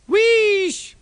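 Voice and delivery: very high, loud steam hiss; steam wheesh. A narrator's reading. James letting off steam because he is pleased, loud high wheesh. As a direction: let off steam for joy. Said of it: "letting off steam".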